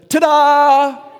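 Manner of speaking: high, loud, triumphant ta-da; a child showing off; proud kid's big reveal; little girl ta-da. Retold or imitated. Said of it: "she goes".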